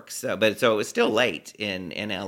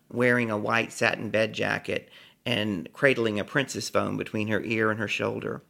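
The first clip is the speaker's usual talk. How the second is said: usual speaking voice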